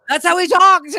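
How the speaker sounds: emphatic protest. Real, not acted. Host defending her imitation to the crew, protesting emphatically. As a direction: insist on it at high pitch.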